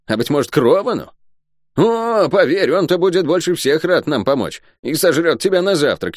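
high-pitched, mocking villainess; mocking cursed woman. A narrator's reading. Cursed woman scoffing at possible allies for Шен, mocking.

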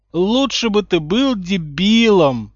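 slow, loud, stressed yell; exasperated. A narrator's reading. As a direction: drag it out in frustration. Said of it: "продолжал Рон, отмахиваясь". (раздражённо)

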